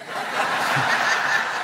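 short breathy chuckle; a little airy laugh; soft laugh. Real, not acted. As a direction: laugh quietly at the joke. Laughs at own joke.

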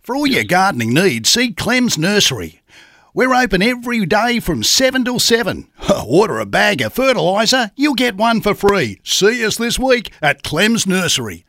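hammed-up country radio ad; Aussie farmer voice. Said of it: "typical man on the land farmer voice".

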